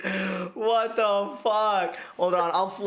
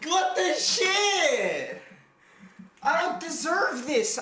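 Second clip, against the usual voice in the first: drawn-out outraged yell; outraged shout; 8 semitones higher, slower than usual. Acted. Mock outrage at low score.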